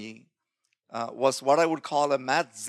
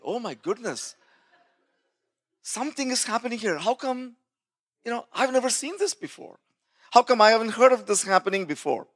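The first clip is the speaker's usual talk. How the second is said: retold amazement; 7 semitones higher than usual. Retells his amazed, baffled thoughts.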